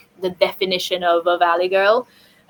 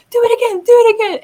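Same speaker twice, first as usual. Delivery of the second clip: excited plea; 13 semitones higher, 7 dB louder, much faster than usual. Retold or imitated. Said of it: "She was like".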